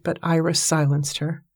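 usual speaking voice

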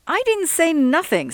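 insistent denial. Acted. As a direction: deny it flatly as the young man. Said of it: "Sol insisted".

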